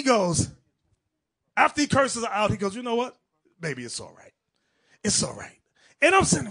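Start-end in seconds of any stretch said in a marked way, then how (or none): 2.8-5.6 weak husband. Acted. Weak husband giving in to his wife, low and resigned. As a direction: voice sinking low and meek. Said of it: "the husband was so weak".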